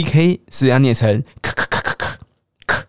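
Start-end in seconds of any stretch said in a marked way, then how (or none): 1.4-2.8 "咳" as a bare puff of K, no vowel; voiceless K. Shown as a demonstration. Teacher drills the CK sound, voiceless "咳" bursts. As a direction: pop "咳" out with silent vocal cords. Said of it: "声带不要震动哦".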